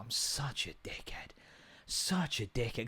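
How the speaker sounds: put-on soft, hushed little-girl voice; hushed imitation of his daughter; toddler quote. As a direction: mimic the toddler, whispery and slow. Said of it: "but she's like".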